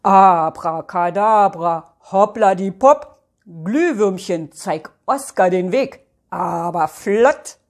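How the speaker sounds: commanding wizardly spell voice; magic tree casting spell. Acted. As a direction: proclaim the magic words, stretching them out. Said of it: "tanzen und spricht".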